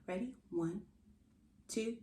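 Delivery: ordinary delivery, nothing marked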